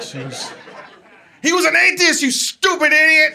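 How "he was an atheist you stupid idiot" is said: high-pitched, loud angry shriek; imitating an outraged sister yelling; sister's yelled outburst. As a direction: yell it out like an uncontrollable heckle. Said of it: "my sister Tourette's was just on point".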